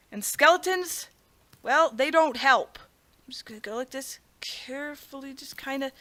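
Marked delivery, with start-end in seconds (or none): 3.3-5.9 very soft, careful half-voice; hushed concentration. Real, not acted. Gamer edging carefully along the parkour, hushed. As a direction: creep through the words so nothing slips.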